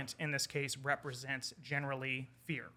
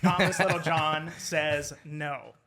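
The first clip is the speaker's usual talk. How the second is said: louder, slow, every word landed; emphatic punchline; 10 dB louder, slower than usual. Real, not acted. Storyteller to host, answering the guess with an emphatic, slow punchline. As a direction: spell out the answer slowly and firmly.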